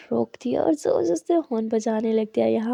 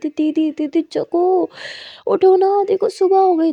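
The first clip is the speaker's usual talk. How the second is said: calling to wake; 5 semitones higher, 4 dB louder, slower than usual. Acted. Muskan shaking sister awake.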